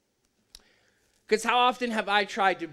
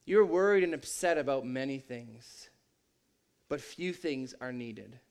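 Jesus gently rebuking; 7 semitones lower, 7 dB quieter than usual. Retold or imitated. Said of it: "Jesus responds and says".

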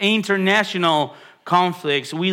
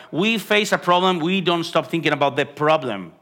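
ordinary talk, nothing marked

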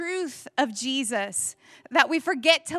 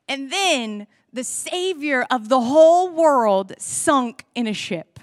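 mock tragic ending; 8 dB louder than usual. Mock ending of gospel story.

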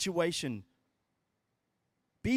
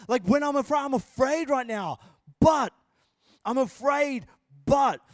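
acted-out frightened plea, higher and louder; freaking out; 3 semitones higher, 6 dB louder than usual. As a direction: play David crying out to God in fear. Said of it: "he's freaking out. And he goes".

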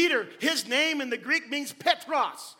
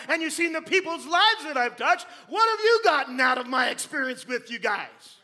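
shouted, insistent, higher questions; Jesus pressing hard; 4 semitones higher, 8 dB louder than usual. Acted. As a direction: hammer each question home to the disciples. Jesus presses his disciples.

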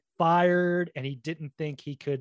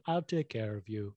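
put-on French giant accent; 5 semitones lower, 5 dB quieter than usual. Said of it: "I really like your Andre the Giant".

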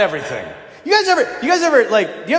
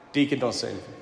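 quiet aside; 9 semitones lower, 10 dB quieter, faster than usual. Real, not acted. Aside to deacon who knows.

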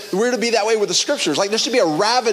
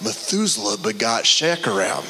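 lower, monotone begat reading; bored genealogy drone; 10 semitones lower than usual. Retold or imitated. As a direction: drone through the list as if bored. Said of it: "you're like".

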